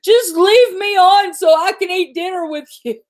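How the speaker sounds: mock tearful voice of a daughter on the phone; daughter's plea. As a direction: imitate the daughter begging to stay on the call. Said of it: "She's like".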